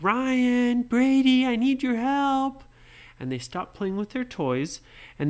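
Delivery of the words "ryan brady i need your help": high, loud call across the island; yelling. Acted. Nora yelling for her brothers Ryan and Brady. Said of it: "she yelled".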